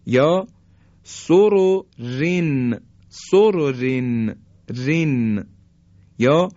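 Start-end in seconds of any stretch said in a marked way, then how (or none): 1.0-4.4 سررن drawn out very slowly, each ر light; slow recited demo. Shown as a demonstration. Tajwid teacher chanting سررن slowly for students.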